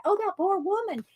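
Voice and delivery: high, light mock pity; a touch of a pitying onlooker; pitying bystander. Bystanders watching her laugh, high mock pity. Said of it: "people around me are looking like".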